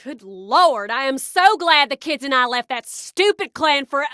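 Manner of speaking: put-on sudden lapse into a Hodunk accent; slipping into Hodunk accent. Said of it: "did I go back into the accent?".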